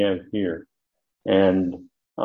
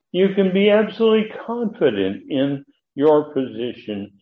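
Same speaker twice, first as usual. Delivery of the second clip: fervent preaching; 9 semitones higher than usual. Acted. Preacher rises to fervent emphasis.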